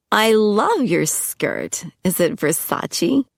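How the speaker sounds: high, slow flirty purr. Acted. Lindsay flirting with Angus about his kilt, slow high coo. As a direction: coo over his skirt.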